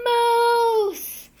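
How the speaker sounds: huge high startled yell. A narrator's reading. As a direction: scream it out in shock. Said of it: "and yelled".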